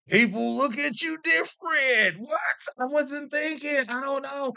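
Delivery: high-pitched, loud protest of cluelessness; comic exasperation. Acted. Dad admitting the daddy jersey backfired, comic exasperation. Exasperated at own embarrassing mistake.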